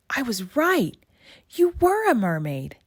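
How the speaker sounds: high, thrilled discovery; excited gasp. Acted. Girl to her friend, excitedly realizing she was right. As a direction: blurt it out, delighted to be right.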